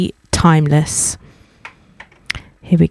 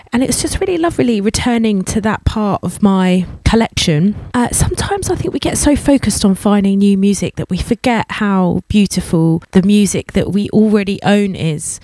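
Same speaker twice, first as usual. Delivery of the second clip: ordinary talk, nothing marked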